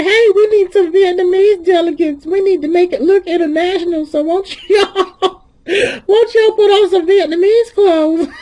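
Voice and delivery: loud retelling that breaks into laughter; laughing mock quote. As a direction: imitate an eager organiser while cracking up. Acts out organiser, cracking up.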